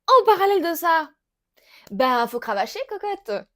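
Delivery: rally her to work hard; emphatic pep talk. Real, not acted.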